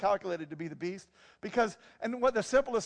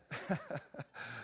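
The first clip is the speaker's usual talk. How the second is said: quiet, breathy laughing fit; helpless low chuckling; real laugh; 6 semitones lower, 11 dB quieter than usual. Real, not acted. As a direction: crack up softly mid-sermon. Cracks up at his own joke.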